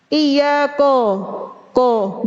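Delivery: "ko" with a heavy, rounded o in place of a; thickened kaf. Shown as a demonstration. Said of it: "Menebalkan huruf kaf". ("ko" dengan kaf ditebalkan)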